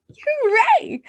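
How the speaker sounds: high loud cheer; shout. Shown as a demonstration. Kids' song cheer, happy shout. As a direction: cheer it out big. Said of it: "give a shout".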